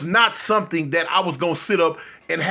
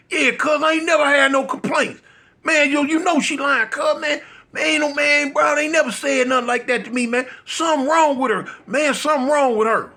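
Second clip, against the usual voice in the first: mock outburst, pitched way up; retold shout; 8 semitones higher than usual. Friend insisting his girlfriend is lying in an imitated shout.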